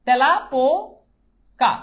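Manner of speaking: তেলাপোকা drawn out slowly as "tela... po... ka"; teacher reading out তেলাপোকা slowly, part by part; spelled-out reading. Shown as a demonstration. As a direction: stretch তেলাপোকা in pieces, as it is written. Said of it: "আমি লিখলাম যে তেলাপোকা".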